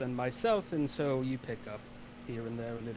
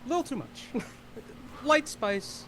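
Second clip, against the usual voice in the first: a lightly voiced higher character; 11 semitones higher than usual. Character politely turning down strong liquor, voiced higher. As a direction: pitch it up into the character's voice.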